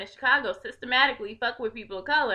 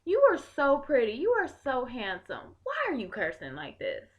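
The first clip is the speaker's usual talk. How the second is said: put-on softer, easy talk to a kid on the bus; calm gentle approach; 4 semitones higher, 4 dB quieter than usual. Speaker calmly addressing rowdy kids on the train, soft and gentle. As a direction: reach them gently, not crazy. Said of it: "I don't come at them crazy".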